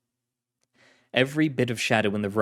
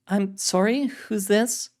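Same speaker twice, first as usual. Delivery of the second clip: female agent voice; 10 semitones higher, slower than usual. Acted. Carlotta voiced.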